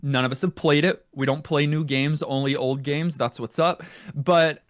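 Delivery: ordinary talk, nothing marked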